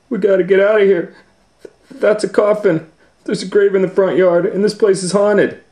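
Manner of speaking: high, loud, fast panic; high frantic rush; panicky Shaggy. Acted. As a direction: rattle it off terrified.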